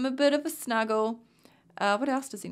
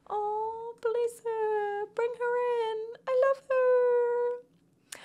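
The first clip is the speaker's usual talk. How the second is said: tender coo; 11 semitones higher, much slower than usual. Real, not acted. Cooing over newborn baby.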